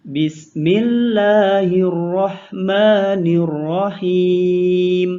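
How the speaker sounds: ordinary talk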